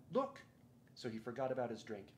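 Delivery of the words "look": sudden high exclamation; cried out. A narrator's reading. Lucy spotting the statue, crying out. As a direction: call out in surprise.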